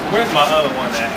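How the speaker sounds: ordinary talk, nothing marked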